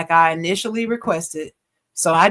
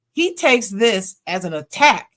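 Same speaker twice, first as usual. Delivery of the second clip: ordinary talk, nothing marked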